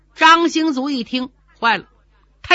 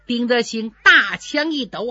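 plain reading voice

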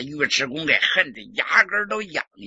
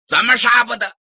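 loud, higher, altered voice demanding why; angry demand; 7 semitones higher, 11 dB louder than usual. Acted. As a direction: snap back at the officer. Prince angrily challenging the officer. (王爷怒声质问)

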